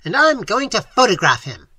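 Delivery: loud, high, exultant announcement; triumphant bawl. A narrator's reading. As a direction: shout it triumphantly. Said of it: "bawled Professor Small triumphantly".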